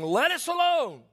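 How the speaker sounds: high, loud demon cry; a possessed man cries out in church. Acted. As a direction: let the demon shriek from the pew. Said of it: "he cried out, saying".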